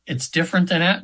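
ordinary talk, nothing marked

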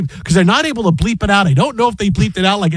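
ordinary talk

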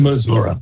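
Missouri echoed as "Missour-uh", ending in uh; local pronunciation. Shown as a demonstration. Correspondent echoing host, Missour-uh.

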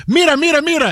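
high, punched repeated Spanish word; mock-urgent butting in; playful interruption. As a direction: cut in theatrically to grab attention. Acts out interrupting phone call.

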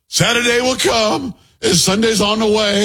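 mock the politician's sudden sermon cadence; mock preacher voice. Host mocking the candidate's new preacher act, hammed-up sermon voice. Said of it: "now it goes to him acting".

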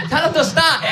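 mock panic, pitched very high; panicked Korean mom. Mom fights the answer machine's feedback, panicked Korean mom. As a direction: imitate a frantic mother in a high voice. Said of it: "she'll lose her mind 'cause of the feedback".

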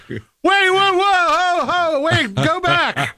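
a listener stopping the ad in disbelief, with a high yelp; startled whoa. Retold or imitated. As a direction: pull up short in a mock panic. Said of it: "And I'm like".

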